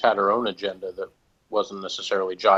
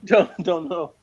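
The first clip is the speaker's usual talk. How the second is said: laughing through the words, higher and louder; 7 semitones higher, 8 dB louder than usual. Real, not acted. Podcast host laughs admitting he hasn't seen it, laughing. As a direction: chuckle while admitting not seeing it.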